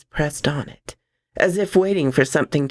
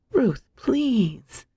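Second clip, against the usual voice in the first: begging; 7 semitones higher than usual. Acted. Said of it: "Carol begged".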